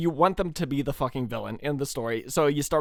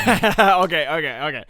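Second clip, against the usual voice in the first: laughing; 2 semitones higher, 9 dB louder than usual. Real, not acted. Laughing after the clip.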